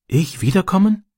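put-on high, tentative boy's question; shy question. A narrator's reading. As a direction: lift it timidly into a rising question. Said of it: "fragte er schüchtern". (schüchtern fragend, hohe Jungenstimme)

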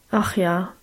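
low, understated sigh of sympathy; a touch of commiseration, pitched a little down; sympathetic sigh. A narrator's reading. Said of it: "seufzte ich teilnahmsvoll".

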